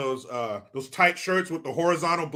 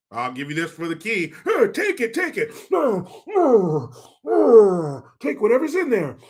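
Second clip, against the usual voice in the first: put-on creepy old man voice; 6 semitones higher, 5 dB louder than usual. Creepy offer of hair for key.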